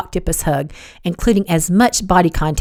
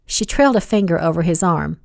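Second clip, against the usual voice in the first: ordinary delivery, nothing marked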